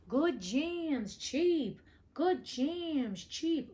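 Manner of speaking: high, drawn-out peddler's call; street vendor crying out. A narrator's reading. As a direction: sing it out slowly like a market seller hawking jam. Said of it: "a peasant woman down the street crying".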